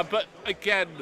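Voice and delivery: normal talking voice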